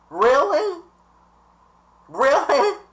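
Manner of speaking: high, stunned repeated question; can't believe what she just heard; incredulous really. Retold or imitated. Repeating the insult back in high disbelief.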